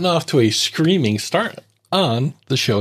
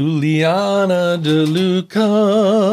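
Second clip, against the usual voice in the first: drawn-out full-name call, slow and higher; yelling child's full name; 7 semitones higher, 3 dB louder, much slower than usual. Acted. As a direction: call her full name like a scolding parent. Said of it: "What, what's wrong with you?".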